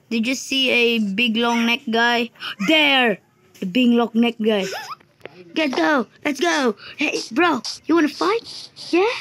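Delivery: ordinary talk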